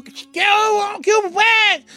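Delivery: very high, comic answering holler; respuesta a gritos. Acted. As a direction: holler back across a lake, high-pitched. Lover shouts back across lake. (grito agudo y cómico de respuesta)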